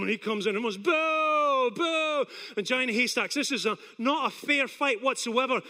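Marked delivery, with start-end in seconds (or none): 0.8-2.3 long, higher-pitched mock boo; imitated crowd booing.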